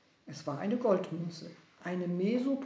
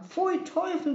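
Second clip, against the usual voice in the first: much higher, louder cry of disgust; 11 semitones higher, 6 dB louder than usual. Acted. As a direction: spit it out after tasting salt water. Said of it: "rief er".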